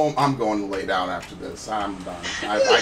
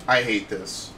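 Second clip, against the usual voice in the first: ordinary talk, nothing marked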